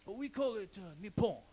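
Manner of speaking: lightly put-on Japanese accent, mocking; a hint of a caricatured Japanese accent. Comic voicing a Japanese guy in a mock Japanese accent. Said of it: "I did kind of a racist accent".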